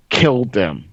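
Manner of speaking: usual speaking voice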